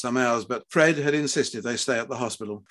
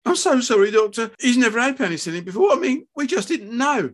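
high-pitched, flustered excuse; distressed apology; 11 semitones higher, 5 dB louder than usual. A narrator's reading. Wife apologising to surgeon about the allergy, distressed. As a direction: apologise anxiously and plead ignorance.

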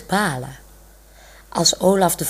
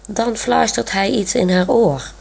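plain reading voice

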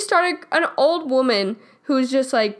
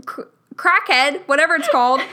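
mock exasperated retort; 2 semitones higher, 4 dB louder than usual. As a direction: throw the line back, fed up. Retells snapping at the woman.

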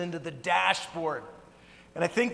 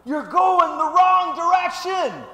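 high, slow, loud warning shout; mock roadside yell; 12 semitones higher, 12 dB louder, slower than usual. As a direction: holler a warning across the road. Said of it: "just yelling at 'em".

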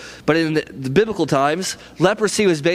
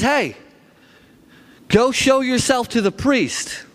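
slow, higher-pitched call without real volume; mock yell back; 6 semitones higher, slower than usual. Jesus answering the lepers from afar, mock yell back. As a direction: lightly imitate calling back across a distance, words stretched. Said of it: "Jesus yells back at them".

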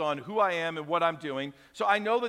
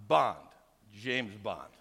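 James Bond; 10 semitones lower, slower than usual. Retold or imitated. Pastor showing off his title, low suave Bond. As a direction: drop the voice low and smooth like Bond. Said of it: "kinda like James Bond".

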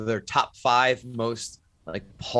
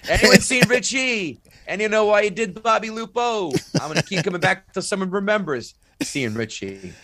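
movie tough-guy impression; 9 semitones higher, 4 dB louder than usual. Comic quoting Out for Justice to fellow fan, hammed-up tough-guy impression. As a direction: call it out like a hammed-up movie line. Acting out Seagal's movie lines.

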